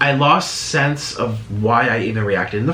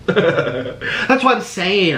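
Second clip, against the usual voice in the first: laughing; 7 semitones higher, much slower than usual. Real, not acted. Laughing at a comment.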